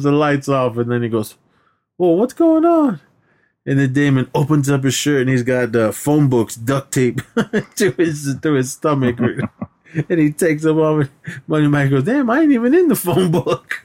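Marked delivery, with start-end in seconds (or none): 2.0-3.0 high, slow startled question; mock startled high voice. Retelling the bathroom scene, high startled squeak. 12.0-13.8 mock indignant voice. Retelling Money Mike's joke, higher indignant voice.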